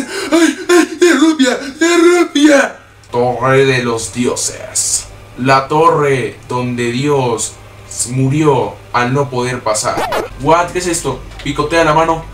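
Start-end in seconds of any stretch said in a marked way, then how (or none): 0.0-2.8 high, loud excited squeal; excited shriek. Real, not acted. Shrieks on finding rupee. 3.1-10.3 very slow, low, ominous reading; dramatic announcer. Pretend. Reads tower name dramatically.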